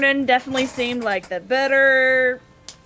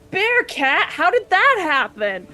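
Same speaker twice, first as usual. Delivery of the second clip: high, louder baffled exclamation; acted astonishment; 7 semitones higher, 4 dB louder than usual. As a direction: blurt it out in playful disbelief. Startled reaction voiced in the story.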